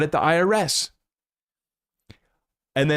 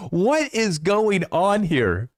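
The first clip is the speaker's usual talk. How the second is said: high, drawn-out bewilderment; slow, high-pitched cry of disbelief; incredulous outburst; 7 semitones higher, much slower than usual. Retold or imitated. Quoting own reaction to clip.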